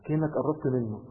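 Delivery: usual speaking voice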